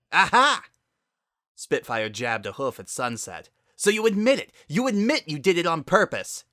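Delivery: high, loud gotcha shout; triumphant accusation. Acted. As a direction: pounce on the admission at full voice. Spitfire accuses her sister triumphantly.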